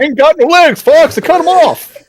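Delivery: very high cartoon radio yell; Star Fox pilot voice. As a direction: shout the classic barrel roll line. Star Fox quotes, hammed up.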